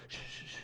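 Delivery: very soft, repeated shush; imitated hushing. Imitating a man silencing his companion underground, soft hush. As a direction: hush someone urgently under the breath. Man hushes heroine in basement.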